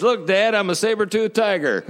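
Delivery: put-on high, loud, delighted kid boasting; a small boy showing off his straw fangs; proud little boy. Pastor's son calling to his dad mid-sermon, high and gleeful.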